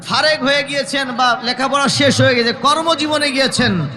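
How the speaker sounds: high-pitched, urgent sermon delivery; forceful preaching. Real, not acted. Preacher warning congregation about busy lives, high impassioned preaching.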